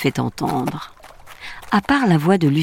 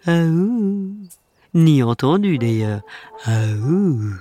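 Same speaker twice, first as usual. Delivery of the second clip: friendly wolf voice. A narrator's reading. Wolf speaks, howls.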